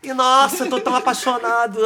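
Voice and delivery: mock lovestruck gush. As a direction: play a hammed-up besotted lover. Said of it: "Aí eu fui".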